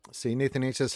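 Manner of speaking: normal talking voice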